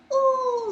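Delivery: very high cooing "ooh"; high-pitched aww-like coo. Acted. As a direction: coo as if at a puppy. Reacting to the dog's cute eyes.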